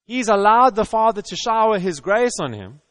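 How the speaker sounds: ordinary talk, nothing marked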